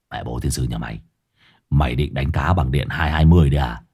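deep, low scolding voice; a rough older brother berating; gruff Hải Nghẹo. A narrator's reading. Scolding the younger brother over electric fishing, low and gruff.

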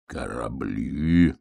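put-on very slow, low old wolf; musing old wolf, chewing lips. Old wolf ponders hedgehog's ships, slow mumbling musing. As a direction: draw the word out low, mulling it. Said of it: "пожевал губами волк".

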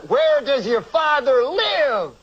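high, slow, drawn-out Cosby voice; Bill Cosby impression. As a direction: stretch it slowly up high. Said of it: "I don't even know if I can do Cosby".

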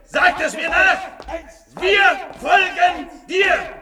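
high, loud, slow shouted harangue; fanatic oration. Acted. Would-be Führer sending his followers to destroy, frenzied. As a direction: rant like a demagogue at a rally.

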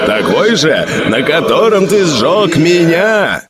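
slow, ghostly anguished cry; father crying out. Acted. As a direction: cry out through burning pain. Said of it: "выкрикнул отец и заорал от боли". (отец кричит сыну из огня — надрывный крик)

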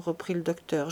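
ordinary delivery, nothing marked

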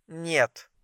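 very soft sleepy refusal; one brief drowsy word, barely voiced; sleepy, short. A narrator's reading. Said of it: "Голос её был сонным, а ответ коротким". (сонно и коротко)

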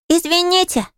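put-on very high little-boy voice; cheerful squeal. A narrator's reading. Small boy bumping into Polly, cheerful squeal. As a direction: pipe up gleefully like a small child. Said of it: "весело заверещал он".

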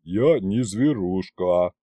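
low, slow grumble; grumbling dragon. A narrator's reading. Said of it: "привычно проворчал Горыныч".

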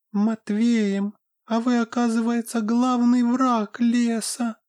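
high, squeaky displeased fairy voice; tiny fairy scolding from a pocket; pixie. Acted. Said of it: "прошептала из кармана недовольно фея".

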